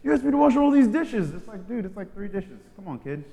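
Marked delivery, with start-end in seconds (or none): 0.0-1.3 high whiny mock husband; hammed-up complaint; mock whining spouse; dramatized complaint. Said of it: "dramatized when you're married".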